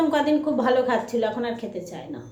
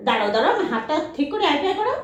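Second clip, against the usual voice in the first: high, loud excited coaxing; laughing high pet encouragement; excited pet talk; 7 semitones higher, 4 dB louder, faster than usual. Real, not acted. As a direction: cheer the dog into a high five. Asking dog for high five.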